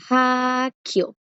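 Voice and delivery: hakyo, lifted and set apart; pronunciación modelo. Shown as a demonstration. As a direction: present hakyo as a model word. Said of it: "Hakyo, escuela". (hakyo pronunciado claramente)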